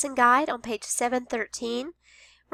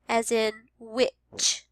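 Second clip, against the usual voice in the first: very slow, witch stretched; slow teacher modeling; slow model word; much slower than usual. Shown as a demonstration. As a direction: say it slowly. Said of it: "Here's another special sound".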